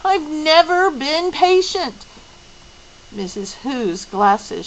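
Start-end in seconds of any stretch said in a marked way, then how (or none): 0.1-2.1 high, loud, passionate protest; a girl crying out passionately. Acted. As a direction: burst out at them, pleading. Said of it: "Meg cried passionately".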